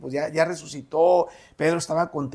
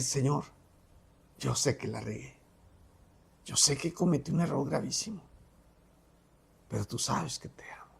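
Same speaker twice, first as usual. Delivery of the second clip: ashamed confession; 7 dB quieter than usual. Retold or imitated.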